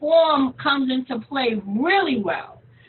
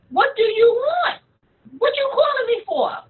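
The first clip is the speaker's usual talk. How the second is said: high, put-out questioning; indignant; 8 semitones higher than usual. Retold or imitated. Voicing Todd questioning a caller after five years, indignant. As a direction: demand to know, pitch jumping up.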